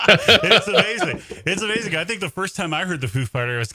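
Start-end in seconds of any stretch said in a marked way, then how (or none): 0.1-1.2 slow, laughing through the words; delighted laugh at the story. Real, not acted. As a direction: laugh it out, amazed. Laughing while reacting.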